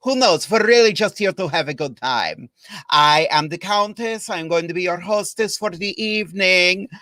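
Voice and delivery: hammed-up vampire countess accent with welcome said as velcome; a camp Transylvanian countess purring hello to the kids; playful vampire accent from a drag hostess. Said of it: "I can't do the accent all night".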